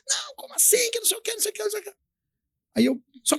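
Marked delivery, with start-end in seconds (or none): 0.1-1.9 high, thin, hushed mimicry of a fuming cashier; mock indignant outburst. Cashier cutting off the customer over wrong change, mock indignant. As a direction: imitate her protests in a soft, squeaky babble. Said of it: "levantou braba".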